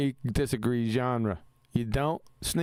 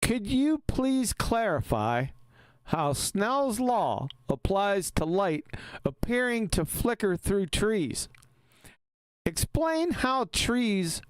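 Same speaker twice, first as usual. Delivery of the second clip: higher, slow, careful read of a viewer's question; reading aloud; 7 semitones higher, slower than usual. Shown as a demonstration. Host reads the viewer's question aloud to his co-host, slow and higher. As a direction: read it out word by word for the audience. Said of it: "I'll read it out loud for you".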